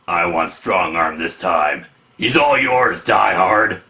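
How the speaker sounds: low, slow tough-guy voice; gruff comic hero. Acted. Team member claims Strong Arm as his target, low hero voice. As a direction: drop the pitch and take it slowly. Second comic character answers in captions.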